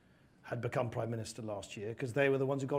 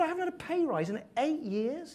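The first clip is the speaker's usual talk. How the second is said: voicing the constituent; 13 semitones higher than usual. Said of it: "And he said".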